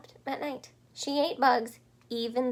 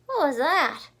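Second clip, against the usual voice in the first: put-on high, loud startled baby bird; startled cry; 6 semitones higher, 9 dB louder than usual. A narrator's reading. Baby bird Flap startled by the bat, loud cry. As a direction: cry out in surprise. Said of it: "cried Flap".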